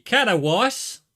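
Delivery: loud, broad Australian take on Katowice; Australian accent. Shown as a demonstration. Streamer showing how Australia would say it, mock Australian. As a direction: Katowice as Aussie "katta-wice", rising at the end. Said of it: "in Australia it'd be".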